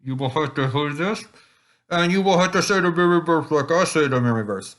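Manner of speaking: high, squashed fish-face voice. Shown as a demonstration. Teaching kids the silly fish-face memory verse voice. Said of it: "we do fish face".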